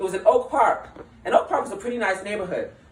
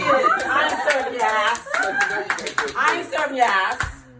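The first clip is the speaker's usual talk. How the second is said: rude server; 5 semitones higher than usual. Retold or imitated. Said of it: "they talk to you like shit".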